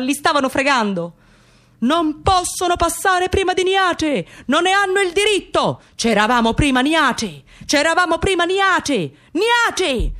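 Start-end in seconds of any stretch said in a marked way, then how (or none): 1.8-10.1 grida indignate, molto più acute e lente; le Bad Sister che urlano. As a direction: protesta stridula di chi si sente scavalcato. (le Bad Sister urlano ai kiddi del funerale, indignate)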